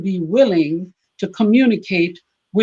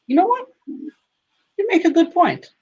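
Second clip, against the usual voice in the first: husband conceding; 11 semitones higher than usual. Retold or imitated.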